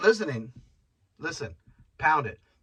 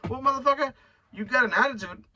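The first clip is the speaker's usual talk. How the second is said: mock indignant; 10 semitones higher, faster than usual. Scolding the rude homeless man.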